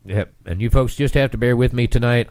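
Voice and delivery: normal talking voice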